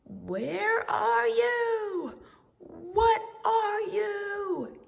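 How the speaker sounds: very slow, high, loud wailing call; frightened cry. A narrator's reading. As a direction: wail at the bumps, drawn out and scared. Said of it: "he cried".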